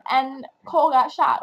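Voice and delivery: ordinary talk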